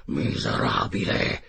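low, drawn-out furious exclamation; terrible roar. A narrator's reading. Enraged captain turning on the Canadian, terrible low roar. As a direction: roar the insult slowly, deep down. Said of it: "terribile da udire".

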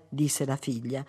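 plain reading voice

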